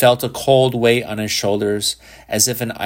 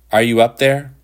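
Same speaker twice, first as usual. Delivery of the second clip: calling up the stairs, raised; 2 semitones higher, 5 dB louder than usual. A narrator's reading. Father calls up the dark stairs, raised call. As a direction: call it up toward the attic. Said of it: "he called".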